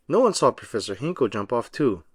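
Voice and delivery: plain reading voice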